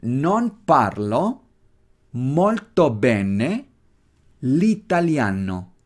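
Non parlo molto bene stretched word by word; slow repeat-after-me; very slow drill. Shown as a demonstration. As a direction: space each word for learners. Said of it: "¿de acuerdo?".

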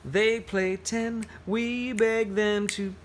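slow, sing-song picture-book verse; reading to children. Acted. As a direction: lean into the rhythm of the rhyme. Reads picture book aloud.

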